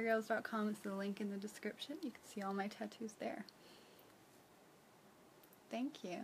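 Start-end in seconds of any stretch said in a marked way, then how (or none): none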